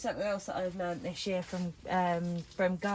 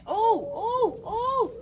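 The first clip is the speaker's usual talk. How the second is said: excited squeal; 12 semitones higher, 5 dB louder, slower than usual. Real, not acted. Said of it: "this is exciting".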